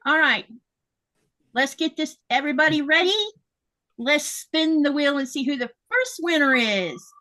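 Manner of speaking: hyped giveaway announcement. Acted. Announces the giveaway wheel winner.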